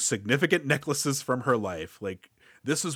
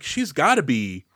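normal talking voice

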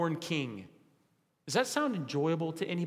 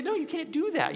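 much higher, protesting no; mock objection; 11 semitones higher than usual. Listener objecting to the preacher, higher protesting no. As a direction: jump up in pitch to object. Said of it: "you'd say".

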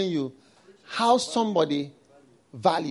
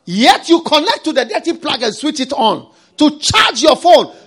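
full-force preaching; 10 semitones higher, 14 dB louder than usual. Acted. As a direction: hammer each phrase at the congregation.

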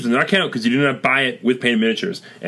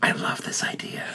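soft breathy whisper; 9 dB quieter than usual. Acted. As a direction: whisper it like a secret. Whispered aside about the idea.

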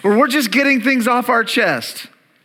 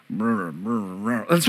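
very low, slow rumbling mumble; grumbling mumble; 14 semitones lower, 8 dB quieter than usual. Shown as a demonstration. Make a low rumbling sound.

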